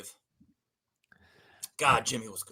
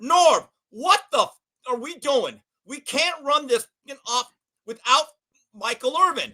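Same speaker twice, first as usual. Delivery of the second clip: frustrated quarterback; 6 semitones higher than usual. Retold or imitated. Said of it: "and he goes".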